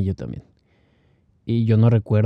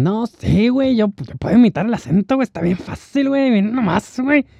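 much higher, fast mock Mexican accent; foreigner boasting; 11 semitones higher than usual. A foreigner bragging he can imitate the accent, in a high mock voice. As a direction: brag in a cartoonish Mexican accent. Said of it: "Y te dicen".

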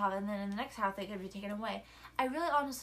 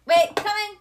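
calling out; 10 semitones higher, 15 dB louder, slower than usual. Real, not acted. Answering someone calling from afar.

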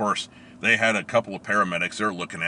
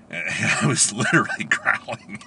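laughing; 4 semitones higher, 3 dB louder, slower than usual. Real, not acted. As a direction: talk and laugh together. Laughing recalling growling.

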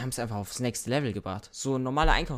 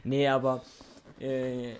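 ordinary delivery

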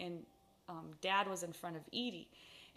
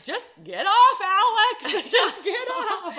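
higher, much louder yell through laughter; hammed-up yell, laughing, the last part slower; laughing mock yell; 11 semitones higher, 16 dB louder, slower than usual. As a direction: shout it at the kid, cracking up. Retold yelling at sister on horse.

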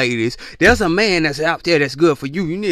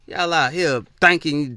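usual speaking voice

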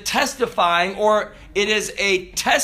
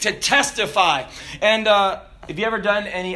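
ordinary delivery

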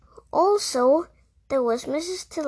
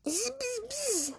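high, soft imitation of a buzz; mocking buzz; 11 semitones higher, 12 dB quieter than usual. A narrator's reading. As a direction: mimic the bee's buzz mockingly. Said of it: "and say".

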